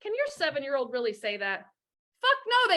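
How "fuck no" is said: high, loud exclaimed refusal; emphatic no. Real, not acted. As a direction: slam the answer down. Answering own rhetorical question forcefully.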